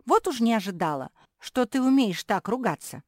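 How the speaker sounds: high, loud scandalized scolding; reproachful exclamation. A narrator's reading. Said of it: "укоризненно воскликнула Куки".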